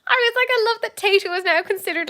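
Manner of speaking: high, loud, helpless amusement; laughing. Real, not acted. Telling Lucy about Tayto in the world aisle, laughing. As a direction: talk straight out of a big laugh.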